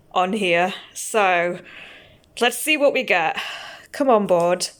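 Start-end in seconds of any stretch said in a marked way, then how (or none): none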